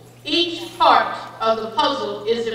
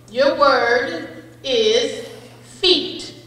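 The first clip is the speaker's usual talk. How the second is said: slow pronouncer voice; much slower than usual. Acted. Spelling bee pronouncer reading word.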